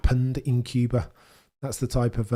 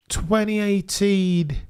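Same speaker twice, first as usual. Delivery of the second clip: drawn-out pondering; 10 semitones higher, much slower than usual. Real, not acted. Drawn-out thinking choice.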